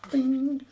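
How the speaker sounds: high, ringing "bing" noise; springing "bing". Retold or imitated. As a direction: imitate a flap popping out. Imitates the flap springing out.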